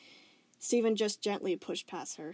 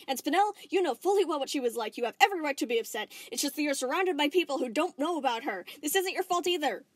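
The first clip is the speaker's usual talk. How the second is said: higher, louder, fast earnest talk; earnest urging; 7 semitones higher, 6 dB louder than usual. Acted. Steven telling Spinel it isn't her fault, earnest. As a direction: reassure her with urgency.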